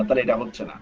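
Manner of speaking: ordinary talk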